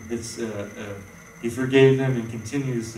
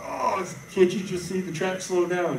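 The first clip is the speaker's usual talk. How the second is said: higher, mocking the driver's frustration; mock-irritated driver in traffic; exasperated driver; 7 semitones higher than usual. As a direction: replay your road rage, sarcastic. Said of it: "I'm like".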